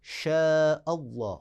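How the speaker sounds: شاء held long before اللہ; stretched madd. Shown as a demonstration. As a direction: draw out the شا of شاء. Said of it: "ایک اور مثال ہے". (شاء کو کھینچ کر)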